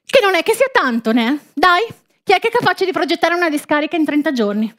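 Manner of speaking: high, fast, outraged townsman rant; indignant villager. Acted. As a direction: fume about the crooked politicians. Villager rants about the dump.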